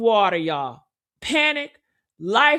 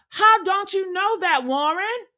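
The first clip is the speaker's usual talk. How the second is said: usual speaking voice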